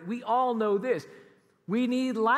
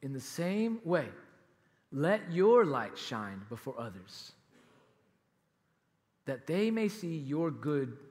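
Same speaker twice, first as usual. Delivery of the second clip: reading scripture; 8 semitones lower than usual. Acted. Preacher reading Jesus' words, low and reverent. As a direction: read the verse reverently and slowly. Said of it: "And then he says this".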